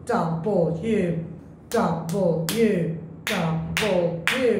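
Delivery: W in three slow separate syllables. Shown as a demonstration. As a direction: break W into dou-ble-you. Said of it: "only one letter has got three syllables". (W তিন সিলেবলে ভেঙে ধীরে)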